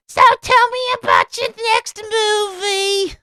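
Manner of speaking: very high, squealing falsetto impression; mock interviewer in absurd falsetto; Opie. Podcaster copying Opie's Amy Poehler impression, piercing silly falsetto. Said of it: "that's Opie's im-, impression, right?".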